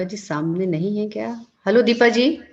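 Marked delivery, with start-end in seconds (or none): 1.7-2.4 louder, higher, rising call. Real, not acted. Host trying to reach the silent guest, calling out. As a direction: call to someone who isn't answering.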